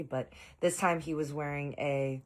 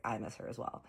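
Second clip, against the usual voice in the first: whispered reply; 3 semitones lower, 8 dB quieter than usual. Retold or imitated. Soft reply about Kristen.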